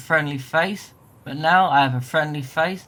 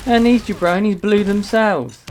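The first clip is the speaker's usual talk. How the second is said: excited exclamation; 5 semitones higher, 6 dB louder than usual. Acted. Joking about exploding settlers.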